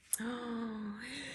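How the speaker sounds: hushed gasp of wonder; tiny amazed intake of breath; soft gasp. Pretend. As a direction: catch your breath in wonder. Storyteller reacts in read-aloud.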